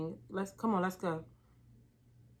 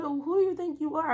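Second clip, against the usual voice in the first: indignant challenge; 8 semitones higher, 3 dB louder than usual. Retold or imitated. Confronting the boss.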